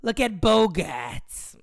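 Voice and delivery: slow drawn-out Boston cheer; mock Boston fan. Imagining his fiancée cheering a Red Sox homer, mock Boston accent. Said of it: "Imagine a cheering for a Red Sox homer".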